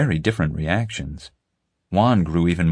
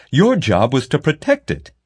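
stern official; 8 semitones higher, 4 dB louder than usual. A narrator's reading.

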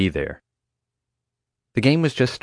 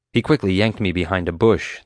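usual speaking voice

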